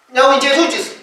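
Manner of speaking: ordinary talk, nothing marked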